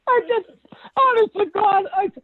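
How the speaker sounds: high, laughing to the point of tears. Real, not acted. Telling Joe about the client, laughing tearfully. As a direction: laugh through the words, cracking into tearful glee. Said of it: "I start laughing at her".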